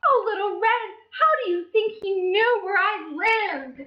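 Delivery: high fussing granny; sorry Granny. Acted. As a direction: comfort Little Red, all apologies. Granny wonders.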